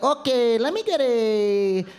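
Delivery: put-on slow, drawn-out sultry Puerto Rican accent; sexy Puerto Rican accent. Woman orders at Subway, sexy Puerto Rican accent. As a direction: stretch each word in a hammed-up Puerto Rican purr. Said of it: "that is the sexiest accent on the planet".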